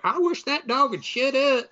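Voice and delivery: high-pitched put-on complaint; mock exasperation. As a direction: whine it as a fed-up neighbor. Young couple annoyed by howling dog.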